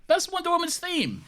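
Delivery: very high mock-outraged squeak; squealed insistence. Real, not acted. Co-host insisting on the theme's name, squealed protest. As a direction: contradict in a high squabbling voice. Childish squabble over a theme.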